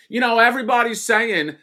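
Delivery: high-pitched put-on accent, loud; [name]. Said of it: "I'm doing that accent".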